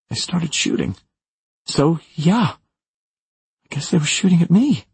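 high, upset confession; fearful, regretful. A narrator's reading. Maura confessing she ran toward the fence, high and fearful. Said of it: "goes up an octave now. I can hear the fear, the regret".

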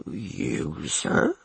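soft stunned mumble, rising; mumbled. A narrator's reading. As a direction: mumble in shock. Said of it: "he mumbled".

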